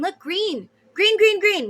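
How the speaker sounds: high, loud burst of green; gleeful repeated exclaim; excited. Real, not acted. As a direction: call it out, thrilled. Spots green again in cards.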